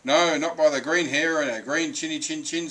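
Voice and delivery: loud, high chanted refusal; defiant little pigs. A narrator's reading. Green pigs refuse the wolf at the door, defiant. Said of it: "said the pigs".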